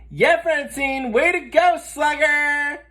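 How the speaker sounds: very high, loud shout of joy; a kid hollering praise; cheering. Acted. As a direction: cheer from the stands. Team cheers homerun.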